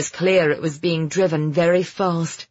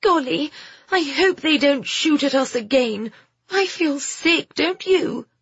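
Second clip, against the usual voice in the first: put-on high, fretful girl's voice; pitched-up youngster, ill at ease; worried girl Phoebe, queasy; 9 semitones higher than usual. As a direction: complain anxiously on a rough ride. Story figure, worried girl.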